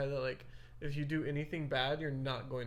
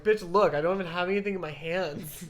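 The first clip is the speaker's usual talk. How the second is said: louder, higher retold snappy comeback; a little snappy; 3 semitones higher, 7 dB louder than usual. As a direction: snap back in protest, acting it out. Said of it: "get a little snappy".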